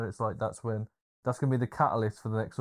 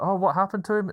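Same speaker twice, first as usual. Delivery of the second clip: shocked question; 9 semitones higher, 5 dB louder than usual. Retold or imitated. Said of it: "and he's like".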